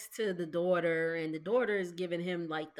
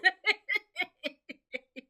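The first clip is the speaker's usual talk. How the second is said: long high cackle; high, helpless laughing fit; 10 semitones higher, 4 dB louder than usual. Real, not acted. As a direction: keep laughing till it trails off. Cracks up at her reaction.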